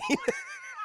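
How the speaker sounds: high burst of laughter; high giggle. Real, not acted. Streamer amused by every Daniel Craig scene, high laugh. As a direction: laugh with delight.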